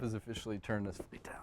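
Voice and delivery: fast secretive whisper. Pretend. Mock official announcement, whispered.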